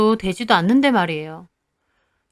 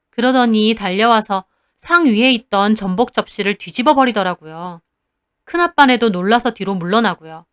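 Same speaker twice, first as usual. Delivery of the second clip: plain reading voice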